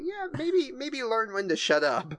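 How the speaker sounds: tentative suggestion, starting high and ending in a laugh; mock listener feedback. Listener gently advising the rambling hosts, mock tentative.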